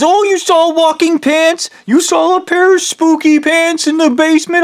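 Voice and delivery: mock him in a high, sneering voice; mocking friend. Friend taunting him about the spooky pants, high mocking voice. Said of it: "And then he's".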